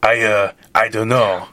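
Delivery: voicing Lafayette with a hammed-up French accent. Playing Lafayette in the fan fiction in a mock French accent. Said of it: "He whispered with his French accent".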